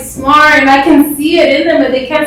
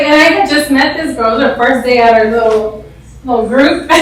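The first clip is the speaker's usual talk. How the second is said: normal talking voice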